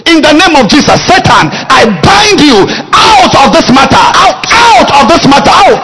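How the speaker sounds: high, loud commanding curse; laying a curse. Shown as a demonstration. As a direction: drive the devil out, shouting. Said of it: "demo binding, laying a curse".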